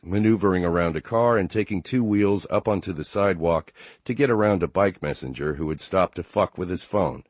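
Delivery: usual speaking voice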